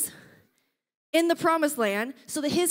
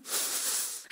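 deflated sigh; 12 dB quieter than usual. Retold or imitated. Resigned reaction.

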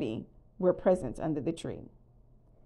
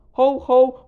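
loud, higher booming "ho, ho"; jolly Santa laugh; 6 semitones higher, 14 dB louder than usual. A narrator's reading. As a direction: belt out Santa's jolly call. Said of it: "cut off by a jolly".

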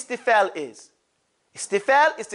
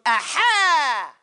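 teacher's demo aha; 4 semitones higher than usual. Shown as a demonstration. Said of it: "everybody say".

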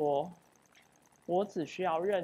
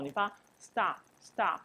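ordinary talk, nothing marked

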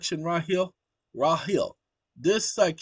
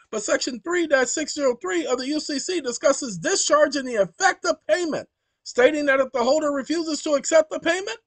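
a higher, louder read-aloud building to a near laugh; reading the AI answer; 13 semitones higher, 6 dB louder than usual. Acted. Reading the chatbot's answer to viewers, growing gleeful and louder. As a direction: read the bot's legal answer with mounting glee.

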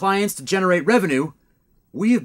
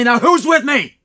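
loud rousing call to the team; rallying shout; 7 semitones higher, 9 dB louder, slower than usual. Acted. Leader rallying his staff, loud rousing call. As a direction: fire up the crew with a big shout.

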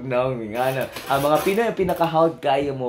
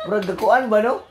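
usual speaking voice

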